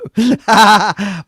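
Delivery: short, high, loud laugh. Real, not acted. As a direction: burst out laughing at your own joke. Host laughs at own joke.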